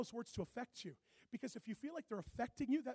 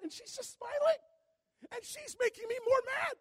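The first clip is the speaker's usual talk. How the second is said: ordinary talk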